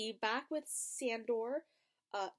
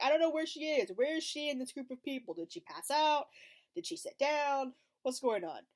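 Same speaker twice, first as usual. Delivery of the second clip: confused fretting; 6 semitones higher, 5 dB louder than usual. Retold or imitated. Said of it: "I'm like".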